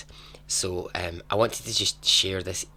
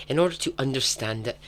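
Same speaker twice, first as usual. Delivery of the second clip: ordinary delivery, nothing marked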